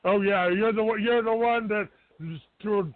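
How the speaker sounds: usual speaking voice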